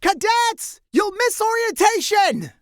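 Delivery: high, loud calling shout; shouting after them. Acted. Colline shouting after departing cadets, high loud yell. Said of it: "with Colline shouting".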